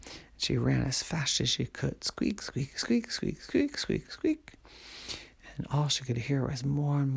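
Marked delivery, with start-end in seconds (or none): 2.1-4.5 squeaks climbing much higher each time; rising squeaks. A narrator's reading. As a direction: pitch soaring up as she runs. Helen runs as fast as she can.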